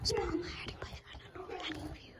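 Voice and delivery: hushed unvoiced whisper. Real, not acted. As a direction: whisper so the seeker can't hear. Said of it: "because I have to whisper".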